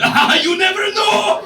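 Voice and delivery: high, loud, drawn-out cry; exuberant shouted quip. Retold or imitated. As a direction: blurt it out at the top of your voice. Imagined reply of counter guy.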